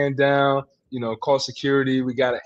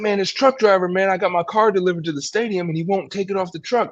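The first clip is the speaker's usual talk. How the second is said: agitated player; 9 semitones higher than usual. Retold or imitated. Player venting to agent about the truck driver, pitched-up and agitated. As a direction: play the upset guy venting fast.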